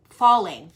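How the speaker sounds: "falling" said carefully as fal-ling; careful "falling" after stumbles; corrected word. Shown as a demonstration.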